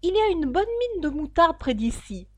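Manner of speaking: a lofty duchess pronouncing her moral. Acted. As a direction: lecture Alice like a pompous duchess.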